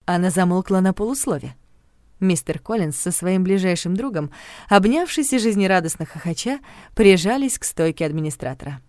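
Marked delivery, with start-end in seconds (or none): none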